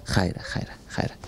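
low, hushed reassurance; soft consoling. Real, not acted. Host reassuring the crying girl, soft and tender. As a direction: murmur it softly to soothe tears.

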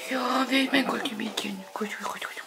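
whispered cooing to the dog; whispering. Real, not acted. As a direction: coo it softly.

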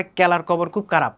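Mymensingh k in place of kh; Mymensingh accent. Retold or imitated. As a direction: drop the puff of air, as in Mymensingh. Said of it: "খ না বলে ক বলে". (ময়মনসিংহের উচ্চারণে খ-কে ক বলা)

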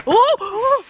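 high yelp breaking into squealing laughter; real high-pitched cackle of surprise; startled shriek and laugh. Real, not acted. Startled on the downhill walk.

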